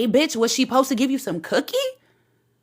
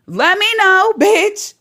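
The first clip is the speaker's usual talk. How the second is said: loud taunt; 8 semitones higher, 5 dB louder, slower than usual. Real, not acted. Taunting him to sue.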